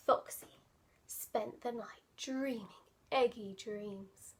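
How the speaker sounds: ordinary delivery, nothing marked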